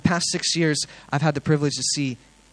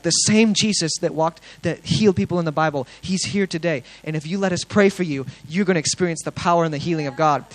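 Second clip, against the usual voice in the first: open-air preaching; 3 semitones higher, 4 dB louder than usual. Retold or imitated. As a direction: retell the friend's bold street sermon. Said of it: "I should open air preach".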